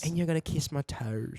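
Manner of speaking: mock Australian-sounding accent; British accent turned Australian. Friend echoes the Queen, accent sliding into Australian. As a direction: attempt British, slip into Australian. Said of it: "This is still Australian".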